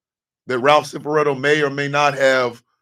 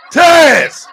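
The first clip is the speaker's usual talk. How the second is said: shouted name; 12 semitones higher, 10 dB louder than usual. Real, not acted. Calling out chatter in disbelief.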